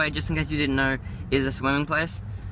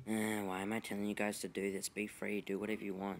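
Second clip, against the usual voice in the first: much softer, lower self-aside; quiet aside; 5 semitones lower, 14 dB quieter than usual. Real, not acted. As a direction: mutter it to yourself, softly. Aside after asking for subscribes.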